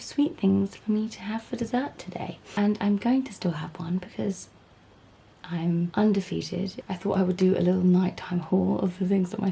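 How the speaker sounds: soft, hushed late-night chat; quiet nighttime voice. Real, not acted. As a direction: talk low so the house stays asleep.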